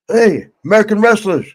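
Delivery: high, loud hail from the doorway; calling out. Retold or imitated. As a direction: call out to them across the door. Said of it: "He goes".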